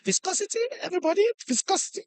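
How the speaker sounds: soft, high, mumbling teacher impression; physics teacher. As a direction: mimic a barely audible teacher, quick and high. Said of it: "the man will just come to class".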